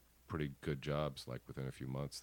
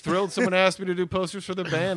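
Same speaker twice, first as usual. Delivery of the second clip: much higher and louder, fading down; thrilled; 11 semitones higher, 14 dB louder than usual. Real, not acted. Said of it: "I was thrilled".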